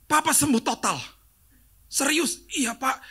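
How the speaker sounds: high, thrilled back-and-forth; excited exchange. Retold or imitated. Caller telling pastor the father healed, high and excited. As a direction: let the joy jump up.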